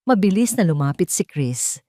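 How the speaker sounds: ordinary delivery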